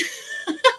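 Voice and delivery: short, very high incredulous laugh; high burst of laughter. Real, not acted. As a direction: laugh out in disbelief.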